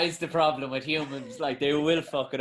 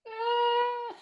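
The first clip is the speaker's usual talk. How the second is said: high, unsure mock voice, much higher than normal; hesitant official; much slower than usual. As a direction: play a dithering checker, pitch way up and wavering. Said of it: "He's like".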